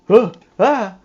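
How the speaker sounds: sudden high, loud gasp and yelp; startled yelp. Real, not acted. Player jump-scared by an old man, yelping loud and high. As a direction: jump out of your skin.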